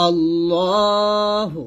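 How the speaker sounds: "আল্লাহু" chanted slowly, middle aw held; lengthened "আল্লাহু". Shown as a demonstration. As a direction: stretch the heavy middle vowel of "আল্লাহু". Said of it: "লতে এক লিপ টান দিবেন". ("আল্লাহু"-র ল টেনে পড়া)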